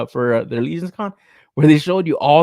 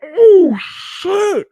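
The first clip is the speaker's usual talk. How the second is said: high, drawn-out mock crowd whooping; loud, stretched-out whoa of mock amazement; imitated hollering; 14 semitones higher, 7 dB louder, much slower than usual. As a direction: imitate hyped-up fans hooting and hollering. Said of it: "hooting and hollering like".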